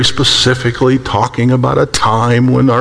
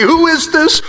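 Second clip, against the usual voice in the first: a much higher, eager cry; amazed question; 13 semitones higher than usual. Acted. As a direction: ask it breathless with wonder. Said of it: "Can you imagine them reading it, say".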